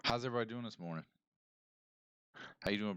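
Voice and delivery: ordinary talk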